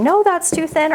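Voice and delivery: high-pitched mock protest; imagined viewers objecting. Viewers talking back to their screen, mock protest. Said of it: "talking to your screen right now saying".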